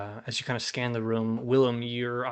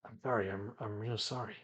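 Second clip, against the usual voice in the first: soft, halting apology; subdued apology; 8 dB quieter than usual. Acted. Borgin handing over the book, looking away, quietly apologetic. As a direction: say sorry quietly, stumbling over it. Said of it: "And he just says".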